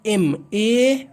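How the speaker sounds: एम, ए split apart very slowly; spelling out the sounds for students; sounded out slowly. Shown as a demonstration. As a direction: break the letters into separate sounds. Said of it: "ध्वनि क्या निकलता है".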